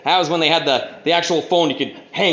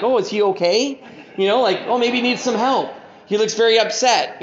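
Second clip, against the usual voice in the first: higher-pitched worried passer-by; imitated concern; 5 semitones higher than usual. Passers-by about an angry man, mock concern. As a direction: fret over a stranger, pitched up.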